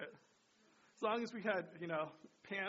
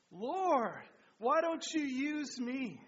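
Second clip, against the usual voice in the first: louder and higher, slow; fervent group repeat; 6 semitones higher, 7 dB louder, much slower than usual. Real, not acted. Congregation fervently repeats the prayer line loud and slow. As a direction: say it back with passion, slowly. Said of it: "The last one".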